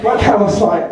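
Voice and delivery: normal talking voice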